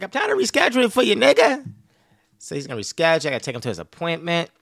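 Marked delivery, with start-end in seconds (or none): 0.0-1.6 higher, louder and fast, lightly voiced; father scolding his son for forgetting; dad's exasperated voice. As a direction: rush it like a fed-up father. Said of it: "He's like".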